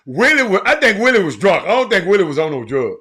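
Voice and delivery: high and loud, still laughing; hyped shout. Real, not acted. Host joking to viewers that Willie was drunk, loud. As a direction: blurt it out through the giggles.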